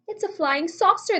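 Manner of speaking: shouting up at the sky; crowd shout. A narrator's reading. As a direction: call it out to the street. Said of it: "they shouted".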